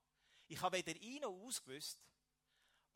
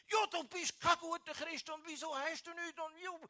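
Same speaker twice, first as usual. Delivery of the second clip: hammed-up furious yell at the mother; retold angry attack; 12 semitones higher, 7 dB louder than usual. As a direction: lash out at the mother in a replayed fury. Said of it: "bis ich keine Luft mehr hatte".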